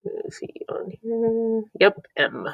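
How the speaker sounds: hushed whisper to herself while searching; soft, unvoiced searching whisper. Real, not acted. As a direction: whisper it as if looking for something. Searching for the Seligman card.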